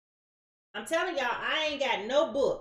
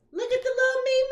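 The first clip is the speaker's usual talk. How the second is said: very high, loud squeal; tiny high-pitched cutesy voice; squeaky cooing; 12 semitones higher, 7 dB louder than usual. Pretend. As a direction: coo it way up high, holding the last word. Cooing over the card's little figure.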